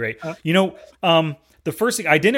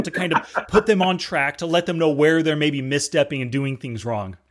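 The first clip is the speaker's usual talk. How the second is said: usual speaking voice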